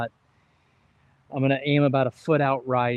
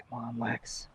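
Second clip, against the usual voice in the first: much softer, under the breath; quiet self-urging; 11 dB quieter, slower than usual. Real, not acted. Golfer to himself before a short putt, hushed urging. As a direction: urge yourself on very quietly.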